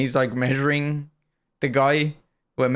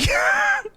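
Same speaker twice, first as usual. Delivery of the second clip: high squeal of laughter. Real, not acted. Laughing at bear video.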